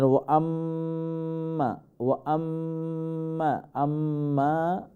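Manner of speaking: "আম্মা" with the m held long and nasal on a level note; long ghunna. Shown as a demonstration. Teacher showing the ghunna rule, long hummed m in "আম্মা". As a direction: hum and stretch the m in "আম্মা". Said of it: "then মিমটা লম্বা হবে".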